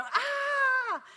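high, long drawn-out wail; re-enacted scream of tormented kids; imitated scream. Kids writhing on the floor like snakes during prayer, imitated scream.

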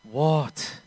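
imitating a huffy teen retort; arrogant teenager. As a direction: throw the word back like an annoyed kid. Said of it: "Like an arrogant, pimply, thirteen to fourteen-year-old".

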